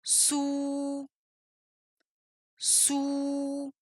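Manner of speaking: "sou" long, soft and level; drawn-out soft sou, twice; whole syllable drill. Shown as a demonstration. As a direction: hold the ou of sou softly on one pitch. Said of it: "en prononçant d'abord la syllabe entière deux fois".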